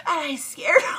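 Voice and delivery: high loud laughing cry; laughing shriek. Real, not acted.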